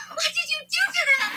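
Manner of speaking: fast, loud, very high scream; panicked shriek at the kidnapper. Acted. The girl screams at Walter about her family. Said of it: "I screamed".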